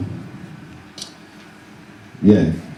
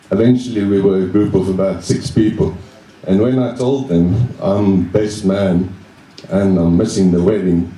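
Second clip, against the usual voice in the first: usual speaking voice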